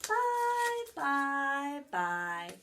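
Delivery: high bouncy bye falling down; playful goodbye. Acted. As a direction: wave goodbye like a little raindrop. Raindrop waves goodbye.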